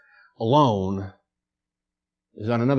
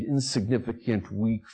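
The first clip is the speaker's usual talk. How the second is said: ordinary talk, nothing marked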